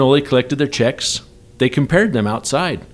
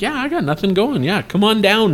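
carefree self; 8 semitones higher than usual. Retold or imitated. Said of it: "I'm like".

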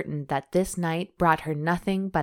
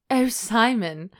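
gasped; 5 semitones higher, slower than usual. A narrator's reading. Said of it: "she gasped".

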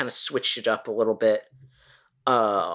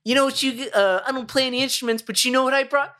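Jay voice; 11 semitones higher than usual. Retold or imitated. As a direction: play the goofy stoner Jay. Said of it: "He was doing the Jay character".

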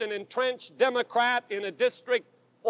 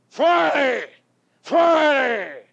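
louder, repeated cry of Friday; shouted; 3 semitones higher, 5 dB louder, slower than usual. Retold or imitated. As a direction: hammer it out as a revival shout.

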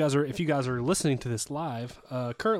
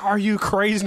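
higher, louder disbelieving cry; incredulous girlfriend; 7 semitones higher, 6 dB louder than usual. Retold or imitated. Girlfriend balking at the trade, loud and incredulous. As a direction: throw it back at him in disbelief. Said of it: "she'd be like".

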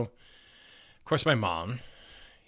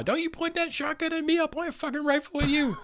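mock threat. [name] threatening father-in-law.

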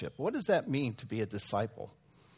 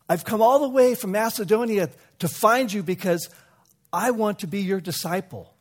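higher and louder, rushing to please; a young prince begging to be taken on; eager pleading; 7 semitones higher, 5 dB louder than usual. Acted.

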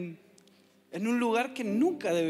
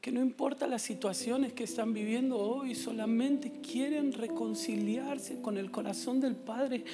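sermon appeal; 5 dB quieter than usual. Acted. Preaching message to congregation.

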